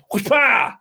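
high, shouted whoosh-pow; shouted pounce noise. Retold or imitated. Host voices the kitten pouncing, a high shouted whoosh-pow. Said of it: "and goes".

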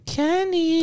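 high sing-song call of Kenny in a mock Chinese accent. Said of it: "did they do that in, like, a Chinese accent?".

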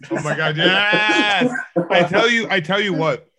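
loud, high, drawn-out cheer; thrilled outburst. Real, not acted. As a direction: shout it out, stretching the yes. Excited reaction to theme pick.

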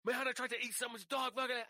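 high, loud exasperated yell; retold shout. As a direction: yell it at the roommate in disbelief. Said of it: "I was like".

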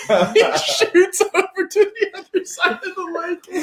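high, squeaky telling through real laughs; real giggling fit mid-story; helpless laughter. Real, not acted. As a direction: struggle to speak while cracking up. Cracks up at dream's climax.

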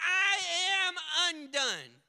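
desperate wail of a lost prophet; crying out. Acted. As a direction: cry out as a broken man before God. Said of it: "Isaiah cries out".

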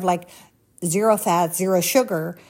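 ordinary talk

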